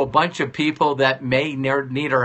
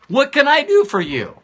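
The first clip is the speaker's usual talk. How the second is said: eager high ask; 10 semitones higher, 4 dB louder than usual. Shown as a demonstration. Speaker urging listeners to ask loved ones, eager high plea. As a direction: lift the repeat much higher and a bit louder. Repeated ask, pushed higher.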